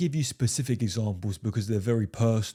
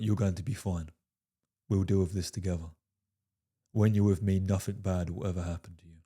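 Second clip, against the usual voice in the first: low, soft, steady reassurance; calm and soothing, no panic at all; 4 semitones lower, 4 dB quieter than usual. Retold or imitated. To his worried girlfriend, calm soft reassurance. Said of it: "just the way I said it like that".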